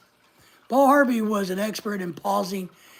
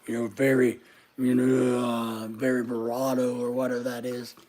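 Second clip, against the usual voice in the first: much lower, booming deep bass voice; James Earl Jones voice; 9 semitones lower, 5 dB quieter than usual. Shown as a demonstration. Wishing aloud for a deep voice, a deep James Earl Jones rumble. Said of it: "I wish I had a James Earl Jones voice".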